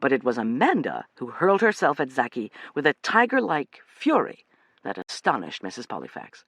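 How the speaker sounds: plain reading voice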